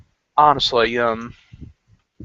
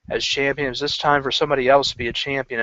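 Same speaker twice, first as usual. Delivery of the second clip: usual speaking voice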